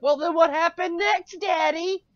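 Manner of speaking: put-on high, eager little kid voice; childish voice. Thrall's son asks his father what happened, high childish voice. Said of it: "the kid's like".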